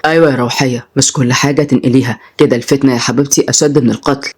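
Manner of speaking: low, calm male teacher; gently admonishing man. Acted. Tutor cautions girl against telling tales, low and calm. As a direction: advise in a low, measured voice.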